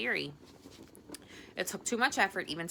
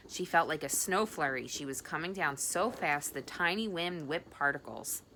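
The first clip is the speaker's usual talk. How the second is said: usual speaking voice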